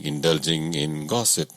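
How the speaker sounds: ordinary delivery, nothing marked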